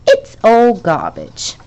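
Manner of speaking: slow, loud happy exclamation; happy cry. A narrator's reading. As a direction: say it gladly, drawn out. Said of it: "Charlie cried happily".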